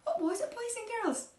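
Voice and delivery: ordinary delivery, nothing marked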